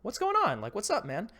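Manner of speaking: breezy high imitation of the boss; casual boss voice. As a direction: play the chill boss, upbeat.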